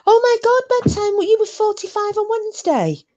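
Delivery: high excited squeal of surprise; shocked delight, voice leaping up; surprised. Real, not acted. Realizing her friend turned forty-five, high surprised squeal.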